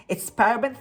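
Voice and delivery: ordinary delivery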